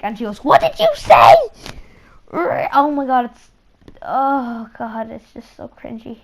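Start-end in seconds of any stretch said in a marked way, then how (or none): 0.4-1.3 high, loud "What did you say?"; indignant shopkeeper. Retold or imitated. Shopkeeper confronting the kid who insulted her, loud and high. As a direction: demand it back, outraged.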